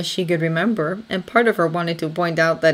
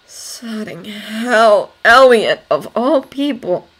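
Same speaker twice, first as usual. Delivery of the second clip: higher, stressed outburst of dismay; shocked swearing; 5 semitones higher, 6 dB louder, much slower than usual. Acted. Thomas swearing aloud at news of a death, shocked. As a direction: curse aloud in disbelief at the news.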